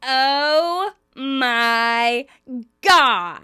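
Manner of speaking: loud, drawn-out catchphrase; each word stretched and belted out; Janice. Retold or imitated. Janice announcing herself to the shocked pair, big mock outcry. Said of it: "Janice is there".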